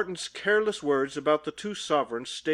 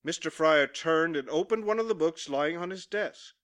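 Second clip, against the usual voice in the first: ordinary delivery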